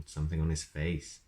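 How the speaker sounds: ordinary delivery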